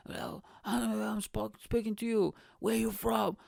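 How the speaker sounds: high-pitched put-on challenge; imitated confrontational local. Pub local demanding where visitors are from, mock confrontational. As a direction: press the strangers in an imitated voice.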